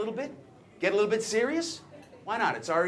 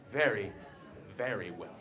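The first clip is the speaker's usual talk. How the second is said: low and sinking, softer on the last words; smug boast; 9 semitones lower, 8 dB quieter than usual. Acted. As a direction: let each word drop, savoring the boast. Smug boast after a pause.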